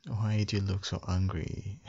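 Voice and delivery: normal talking voice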